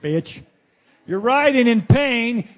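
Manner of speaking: ordinary talk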